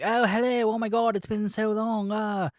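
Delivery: high, gushing mock British greeting; British accent. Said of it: "they're all British ... trickling in some, uh, British accents".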